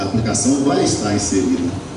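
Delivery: ordinary talk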